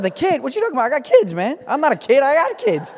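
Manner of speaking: an amused, indignant denial; incredulous protest. Retold or imitated. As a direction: laugh off being called a kid. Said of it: "I said".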